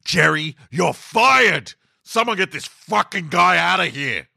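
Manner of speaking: high furious yell at Jerry; Michael loses it and screams at Jerry, furious; exploding boss. Acted. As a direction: boss blows up, fires him on the spot. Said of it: "Michael exploded".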